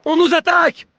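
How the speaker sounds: alarm shout. Acted. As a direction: sound the alarm loudly. Alarm call in battle game.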